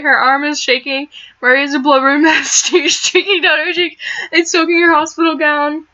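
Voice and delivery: usual speaking voice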